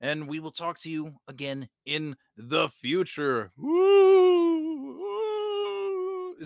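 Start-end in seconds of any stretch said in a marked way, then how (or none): 3.6-6.4 high spooky ghost wail; ghost woo. Acted. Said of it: "going for, like, a, like, a Ghost of Christmas Future".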